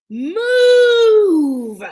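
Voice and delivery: very high, loud held shout; top of her lungs. Acted. Usha shouting at the wall at the top of her lungs. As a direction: throw the whole voice at it. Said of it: "Usha shouted at the top of her lungs".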